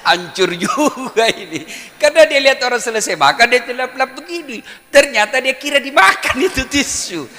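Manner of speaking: high, loud, laughing through it; loud laughing narration; laughing loudly. Real, not acted. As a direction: crack up while telling it. Laughing at the story.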